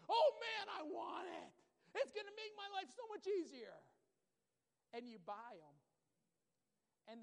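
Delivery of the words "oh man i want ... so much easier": excited. Retold or imitated. Eager buyer wanting the gadget.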